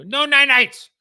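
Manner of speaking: put-on loud, high toddler wail refusing bed; screaming toddler. Toddler refusing bed to his dad, high shrieking wail. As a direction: wail it like a kid fighting bedtime. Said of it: "He go".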